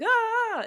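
high, loud imitated crowd scream; short burst of pretend panic; mock scream. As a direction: cry out like a startled crowd.

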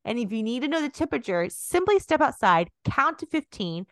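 loud, high huckster sales pitch; salesman pitch. Retold or imitated. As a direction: sell it like a cheesy infomercial. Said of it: "be like".

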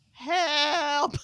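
high, loud, playful wail; hammed-up distressed yell for help; mock cry for help. Mom joking about her son bringing home sickness, mock-desperate.

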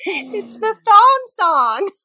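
high, drawn-out triumphant answer; gleeful reveal. Real, not acted. To her friend, gleefully revealing the song title. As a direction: sing out the answer.

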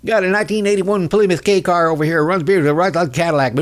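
fast, high Southern used-car salesman; Doug Collins. Retold or imitated. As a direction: rattle off the sales pitch in a mock twang. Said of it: "used car lot".

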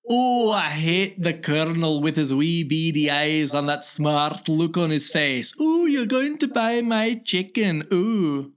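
hammed-up Scottish accent. Podcaster murdering a Scottish accent on request. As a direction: do a broad, over-the-top Scottish movie character. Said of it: "Scottish accent".

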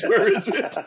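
high laughing mock yell; hammed-up intruder scream. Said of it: "comes into my door right now and screams".

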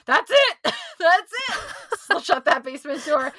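high, loud, hammed-up exasperation; mock mom yelling. Mom jokingly banishing kids to the basement, mock shouting. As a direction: shout it like a mock fed-up mom, laughing between.

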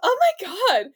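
high, loud starstruck gushing; hyping up. Retold or imitated. Caller hyping up her sparky mate, gushing high and loud. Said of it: "speaking of hyping up".

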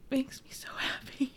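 whispered through real tears; tearful whisper. Real, not acted. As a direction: barely get it out through happy tears. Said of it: "I'm actually getting teary-eyed".

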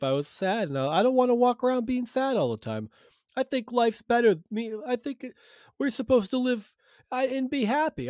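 caller impression. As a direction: raise the voice into a whiny caller. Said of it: "He's like".

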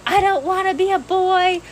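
high, whiny wail of a grown woman; imitating her own meltdown in a high whine; mock tantrum. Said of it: "sobbing in the parking lot and throwing a temper tantrum".

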